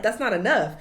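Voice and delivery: ordinary talk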